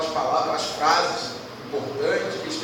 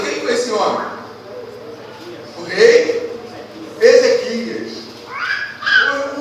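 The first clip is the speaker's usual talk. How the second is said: preaching at full force; 7 semitones higher, 8 dB louder than usual. Acted. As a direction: drive the blessing home to the church. (pregação inflamada, em voz alta)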